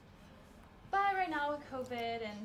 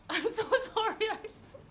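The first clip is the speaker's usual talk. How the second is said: laughing apology; 7 semitones higher, faster than usual. Real, not acted. Laughing apology about cat.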